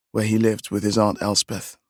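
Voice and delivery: ordinary delivery, nothing marked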